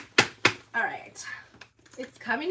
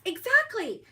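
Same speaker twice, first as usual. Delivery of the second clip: excited agreement; 11 semitones higher, 6 dB louder than usual. Real, not acted. Agreeing with viewer's joke.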